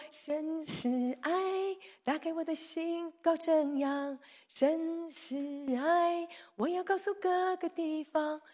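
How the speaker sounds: slow, high, drawn-out devoted voice of Paul; tender praise. Retold or imitated. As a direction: lift each word slowly like a hymn. Paul's changed heart, quoted praise.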